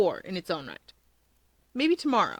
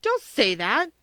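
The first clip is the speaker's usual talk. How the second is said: louder, protesting cry; a loud protest to Yoichi; cried out; 5 semitones higher, 5 dB louder, slower than usual. A narrator's reading. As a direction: push back hard on his words. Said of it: "Kudo cried".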